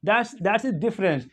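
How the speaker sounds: usual speaking voice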